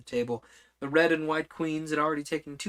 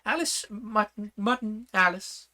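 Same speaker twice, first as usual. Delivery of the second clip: high, slow royal introduction; formal introduction; 8 semitones higher than usual. Acted. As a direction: present them grandly, one name at a time. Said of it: "said the Red Queen".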